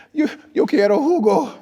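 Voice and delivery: very high, loud mock plea; dazed asking. Exhausted driver asking a shop woman for juice, dazed plea. Dazed, asks in wrong language.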